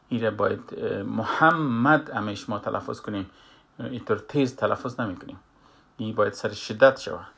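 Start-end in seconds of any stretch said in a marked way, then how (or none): none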